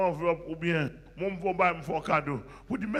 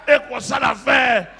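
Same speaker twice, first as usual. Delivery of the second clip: preaching refrain; 4 semitones higher, 12 dB louder, slower than usual. Real, not acted.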